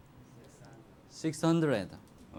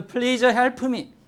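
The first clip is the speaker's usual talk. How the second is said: loud, raised-pitch cry for help; shouted plea; 7 semitones higher, 7 dB louder than usual. Acted. People outside the ark beg Noah for help, shouted plea. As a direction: call out pleading to be helped.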